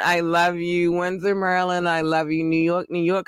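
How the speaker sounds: ordinary talk, nothing marked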